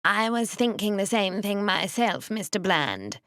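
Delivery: thin, nasal, whining and slow. Acted. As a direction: whine it through the nose. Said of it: "in his whining nasal voice".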